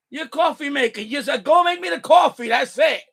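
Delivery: fed-up demand, shouted; ranting shout. Real, not acted. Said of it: "here goes my rant".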